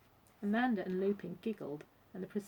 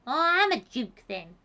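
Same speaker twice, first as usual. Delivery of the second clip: loud comeback, high then dropping; cheeky monkey; 5 semitones higher, 10 dB louder than usual. A narrator's reading. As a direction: toss back a loud retort. Monkey's retort in shouted exchange.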